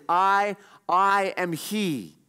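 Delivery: stressed "I", pause, then slow "I am he"; emphatic. Acted. Preacher showing how God identifies himself as forgiver, emphatic. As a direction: hit each "I" hard and stretch out "I am he".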